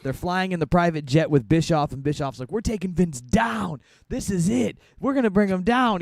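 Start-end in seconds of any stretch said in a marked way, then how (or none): 2.5-6.0 imitating a pumped-up boss, pitch rising and louder; hyped up. Bischoff on the jet rallying his crew, mock hyped-up. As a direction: sell it like a boss sure he will win. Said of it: "he's all hype on this".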